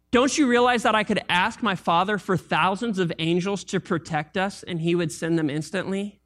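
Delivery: normal talking voice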